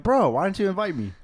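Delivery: high, loud mocking whine; whiny friend imitation. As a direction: mimic a hurt, whiny buddy. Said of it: "you're like".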